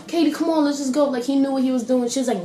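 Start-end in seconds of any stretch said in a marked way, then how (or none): 0.1-1.0 higher-pitched mock coaxing; urging. Boyfriend urging Katie to leave the bank, imitated urging. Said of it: "her boyfriend is like".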